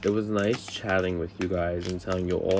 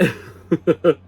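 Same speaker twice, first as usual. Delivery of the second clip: laughing; 7 semitones higher, 8 dB louder than usual. Real, not acted. Laughs at own story.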